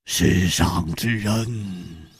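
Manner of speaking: low, very slow, deliberate; slowly. Acted. Killer eyeing Zhang Xiaolin before answering, slowly. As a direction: draw each word out low. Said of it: "缓缓地说".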